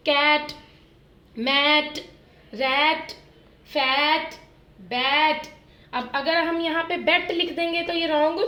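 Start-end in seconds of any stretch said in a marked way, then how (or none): 0.1-5.5 words called out louder for the class to echo; louder call-and-repeat chant. Shown as a demonstration. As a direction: say each word out loud so they repeat it. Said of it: "बोल के देखना है आपने ma'am के साथ".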